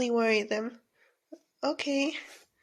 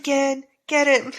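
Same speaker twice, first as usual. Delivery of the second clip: ordinary talk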